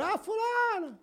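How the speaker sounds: high call back into the kitchen. Retold or imitated. Shop clerk calling a coworker from the kitchen, high call. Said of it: "gritou lá dentro".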